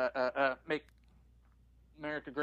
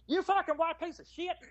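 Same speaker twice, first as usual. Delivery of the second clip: Gomer Pyle impression; 13 semitones higher than usual. Gomer Pyle impression for the friends. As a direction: play the dim country boy yelling an insult. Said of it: "That's a good impression of Gomer Pyle".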